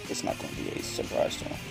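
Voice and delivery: ordinary delivery, nothing marked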